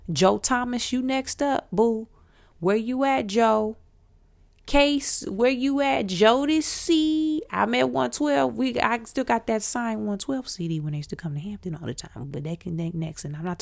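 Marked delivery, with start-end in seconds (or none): 0.1-8.6 playful calling out. Pretend. Calling out absent singers.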